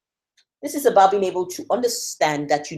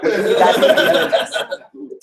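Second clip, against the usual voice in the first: laughing; 3 semitones higher, much slower than usual. Real, not acted. Laughing encouragement to the group.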